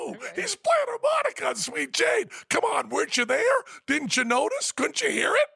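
very high, loud insistent shout; a giddy fan yelling; excited shouting. Retold or imitated. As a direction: shout it up at him in delight. Insisting to furious Lou.

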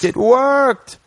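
high, jubilant cry; imitating Doc's high triumphant exclamation; excited Doc. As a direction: celebrate like the plan just paid off. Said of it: "excited 'cause it worked".

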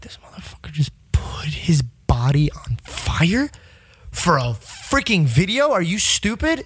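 hushed, slow, stunned disbelief; can't believe what he's watching; incredulous whisper watching the fire stunt. Retold or imitated. Shocked at burning stunt.

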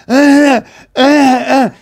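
loud high effortful grunts; mock grunts of frantic swimming; straining grunts. Boy swimming against the current, straining grunts. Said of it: "He's like".

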